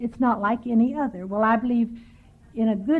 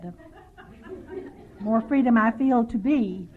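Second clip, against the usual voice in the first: ordinary delivery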